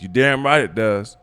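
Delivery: slow, loud and proud; booming grandpa brag; proud loud boast. Acted. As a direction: declare it like a proud old man.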